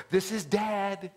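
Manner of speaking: drawn-out greeting to a little one; imitating a father announcing himself to the belly; doting dad-to-be. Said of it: "I would say this".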